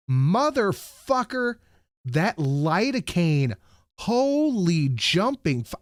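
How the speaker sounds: high, drawn-out pained cursing; exasperated re-enactment of being hurt; reliving sharp pain. Retold or imitated. Reliving the painful lidocaine injection.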